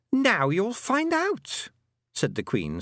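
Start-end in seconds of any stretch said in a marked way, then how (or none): 0.1-1.7 high, slow, sweet menace; sweetly smiling. A narrator's reading. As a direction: threaten slowly through a sweet smile. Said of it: "smiling sweetly".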